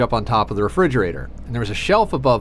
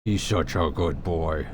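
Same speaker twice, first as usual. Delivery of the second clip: soft cooing; 7 semitones lower, 6 dB quieter, slower than usual. Real, not acted. Cooing to the cat.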